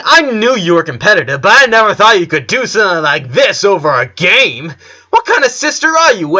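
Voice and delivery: loud, high, jeering taunt; mock her mercilessly, voice climbing; cruel taunting. Ryan taunts Lynn about brother.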